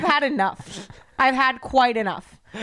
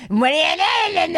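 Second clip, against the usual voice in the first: high, drawn-out whiny snap, imitating husband; mocking impression of an irritable husband; mock snapping; 7 semitones higher, 4 dB louder, slower than usual. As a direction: snap back in a put-on voice. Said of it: "and snaps at me".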